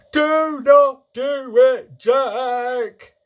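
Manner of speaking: high, very loud, slow screech. A narrator's reading. Giant pleading with Jack in a nightmare, screeching. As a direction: screech it, stretching every word. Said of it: "he screeched".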